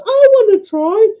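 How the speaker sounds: high, pleading falsetto; eager joke nun. Acted. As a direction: beg for a turn in a squeaky voice.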